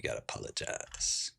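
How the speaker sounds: whispered demand. Acted.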